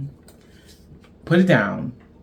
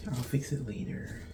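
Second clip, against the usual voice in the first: whispered to herself, much softer; 14 dB quieter than usual. Real, not acted. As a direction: whisper it while fixing the mess. Mutters at tangled yarn.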